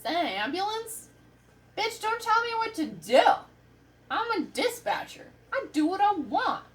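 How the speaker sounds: high, loud mock outrage; indignant sass. Dispatcher retorting to a demanding caller, indignant sass. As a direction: snap back, swooping up high.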